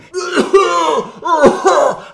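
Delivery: high, loud hammed-up coughing fit; mock choking cough. Walks into toxic smoke.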